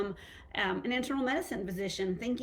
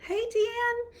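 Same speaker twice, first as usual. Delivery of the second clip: sing-song greeting; 13 semitones higher, 3 dB louder than usual. Real, not acted. Greeting a viewer in the live chat.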